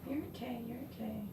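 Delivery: calm the nervous dog gently; soothing. Real, not acted.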